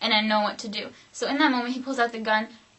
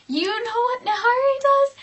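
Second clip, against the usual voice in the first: high, loud squealed question; incredulous repeat; 12 semitones higher, 6 dB louder than usual. Real, not acted. Storyteller repeating her question to the viewers, incredulous. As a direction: pitch it way up, louder, rising in disbelief.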